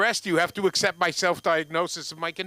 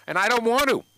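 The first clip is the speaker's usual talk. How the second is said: higher, louder insistence; emphatic punchline; 7 semitones higher, 6 dB louder than usual. Real, not acted. To the audience, emphatic punch line. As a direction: push the joke home hard.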